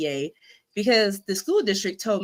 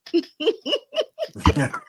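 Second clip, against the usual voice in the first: laughing; 11 semitones higher, 5 dB louder than usual. Real, not acted. Laughs at own joke.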